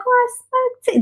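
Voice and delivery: very high, soft feminine voice; imitating her speech. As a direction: mimic her with a soft, high-pitched lilt. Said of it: "this is exactly how she speaks".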